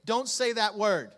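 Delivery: a hint of a stern parent, drawn out; parent scolding. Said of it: "what you would tell your kids".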